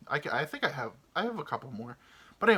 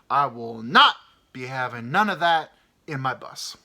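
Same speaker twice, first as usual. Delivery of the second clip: angry bus driver; 5 semitones higher, 11 dB louder than usual. Retold or imitated. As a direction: lay down the law to the whole bus. Angry driver addresses passengers.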